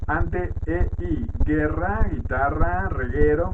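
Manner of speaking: dictation-style list reading. Acted. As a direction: read out each letter and example like a teacher dictating. Reads letters and example words aloud. (lectura de lista en voz alta, como dictado)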